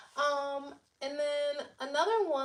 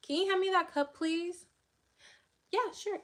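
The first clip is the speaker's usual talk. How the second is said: high, bright exaggerated politeness, louder; sweet polite request; 7 semitones higher, 4 dB louder than usual. Shown as a demonstration. To her mom in the car, a high sweet polite ask. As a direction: act out asking for a cup, very sweetly. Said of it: "I can say it like this".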